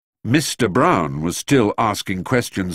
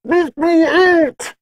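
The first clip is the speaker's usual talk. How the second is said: much higher, louder desperate shout, drawn out; crying out; 7 dB louder, much slower than usual. A narrator's reading. Trapped man behind the door cries out, loud and desperate. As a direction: yell through the locked door to be freed. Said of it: "and a voice cried".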